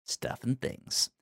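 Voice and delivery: whispered. Real, not acted. Echoing joke.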